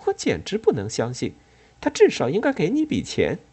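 scornful, rising to a high indignant end; dry disdain. A narrator's reading. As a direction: scoff at the offer. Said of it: "他不屑地说".

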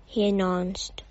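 ordinary delivery, nothing marked

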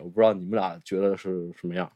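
ordinary delivery

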